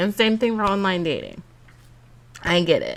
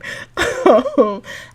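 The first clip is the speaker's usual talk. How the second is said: amused laugh; 5 semitones higher, 6 dB louder than usual. Real, not acted. Laughs at own joke.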